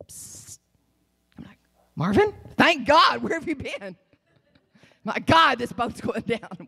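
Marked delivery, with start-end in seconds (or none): 0.1-0.6 faint hissed signal; very soft psst under the noise; voiceless attention-getting hiss; imitated psst. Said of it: "I heard in all that noise". 1.9-6.7 higher-pitched, pleading call; acted frantic relief. Acted plea to rescuer in storm.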